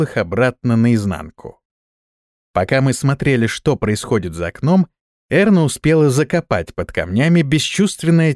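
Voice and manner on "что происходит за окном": ordinary delivery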